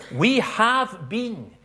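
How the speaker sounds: "we" high and loud, very slow; emphatic. Shown as a demonstration. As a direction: stamp "we" firmly, slowly. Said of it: "Paul wants to make it clear".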